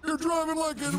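put-on high scolding old man; old guy voice. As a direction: scold like a grumpy geezer. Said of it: "Old guy voice R P".

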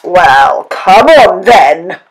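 loud, drawn-out challenge; big goat's cry. A narrator's reading. Big billy goat dares the troll, crying out loudly. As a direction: cry it out as a bold dare. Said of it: "cried the big billy goat".

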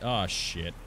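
usual speaking voice

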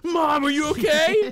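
high-pitched yelling son; high shout. Retold or imitated. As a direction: shriek it out high and loud. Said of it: "and he goes".

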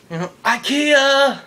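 high, loud, slowed-down ikea; overexcited blurt. Acted. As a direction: shout ikea like a proud bad joke. His eager awkward reply.

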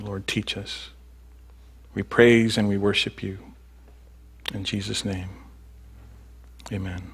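hushed pastor's prayer. Real, not acted. Leads the congregation in prayer.

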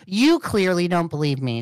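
usual speaking voice

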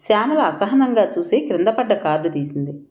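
usual speaking voice

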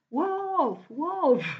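high mock cry of "Wolf, wolf"; boy crying wolf. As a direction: call out "Wolf" like the boy in the fable. Boy in story cries wolf.

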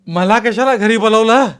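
a high, loud wail of protest; crying shout. A narrator's reading. Young husband yelling at his family, high and loud. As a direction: cry it out loud in despair. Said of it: "असं म्हणून तो ओरडू लागला".

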